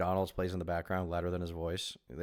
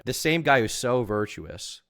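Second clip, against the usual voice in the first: ordinary delivery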